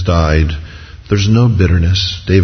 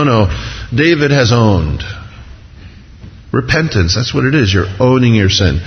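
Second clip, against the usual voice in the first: ordinary delivery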